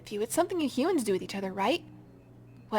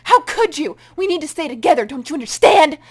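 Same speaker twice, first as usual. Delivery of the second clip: furious outburst; 4 semitones higher, 13 dB louder than usual. Acted. Creature enraged at betrayal.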